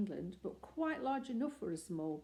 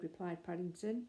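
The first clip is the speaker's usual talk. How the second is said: usual speaking voice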